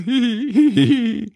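high, loud tittering laugh; high hee-hee cackle; cat's giggle. A narrator's reading. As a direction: giggle shrilly as a tickled cat. Cat laughs at its joke.

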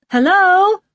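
high, loud call into the distance; shouting. A narrator's reading. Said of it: "Lisa shouts".

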